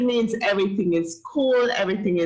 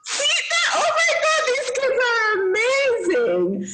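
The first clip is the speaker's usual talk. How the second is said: delighted shout. Real, not acted. Teacher delighted by kids' Patois.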